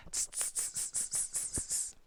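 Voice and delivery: soft voiceless tss sounds in a row; monkey "tss" chatter. Acted. Inviting children to copy the monkeys, hissed tss-tss. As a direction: imitate teasing monkeys through the teeth. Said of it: "do that with me".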